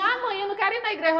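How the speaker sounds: put-on quick high-pitched kid refusing; whiny child. Child refusing church, retold.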